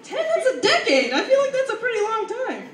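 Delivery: high-pitched indignant retort; hammed-up high protest; mock incredulous. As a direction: squeak it out in disbelief. Retorting to people's lukewarm reaction.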